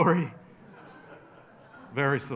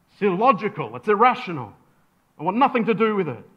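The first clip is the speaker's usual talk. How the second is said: louder, higher, mounting outrage; indignant rejection; 5 semitones higher, 8 dB louder, faster than usual. Acted. Pharisee rejecting someone's faith, loud and indignant. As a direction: throw it out forcefully, building to the last line.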